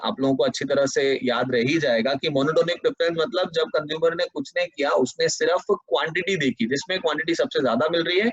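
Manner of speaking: usual speaking voice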